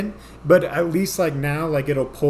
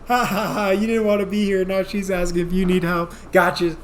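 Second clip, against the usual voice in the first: high teasing sing-song; mocking girlfriend; 5 semitones higher than usual. Girlfriend teasing him from the aisle corner, high mocking sing-song. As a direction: taunt him like a gleeful girlfriend. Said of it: "laughing, going".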